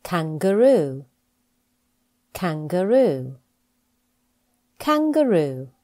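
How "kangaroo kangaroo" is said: ordinary talk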